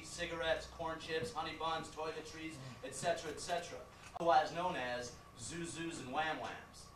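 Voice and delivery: ordinary delivery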